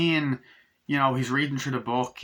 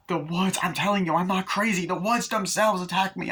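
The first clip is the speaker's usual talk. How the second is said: higher, pleading imitation; mock desperate insistence; 6 semitones higher than usual. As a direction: plead to be believed, playacted. Acts victim insisting she was attacked.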